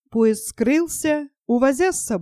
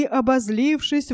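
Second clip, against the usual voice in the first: usual speaking voice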